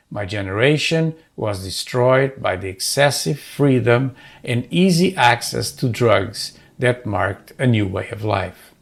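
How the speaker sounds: usual speaking voice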